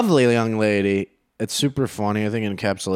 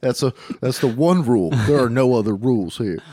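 put-on Texas accent; 2 semitones higher than usual. Texas mayor insisting on one rule, Texas accent. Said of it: "I can do Texas".

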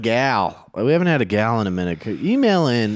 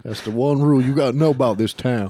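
put-on Texas accent; 3 semitones higher than usual. Texas mayor laying down the rule, Texas accent. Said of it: "I can do Texas".

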